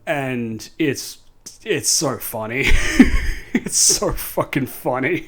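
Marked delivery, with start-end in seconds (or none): 2.7-3.5 breathless silent high-pitched laugh; airy giggle fit; high wheezing laugh. Real, not acted. Cracking up at the movie.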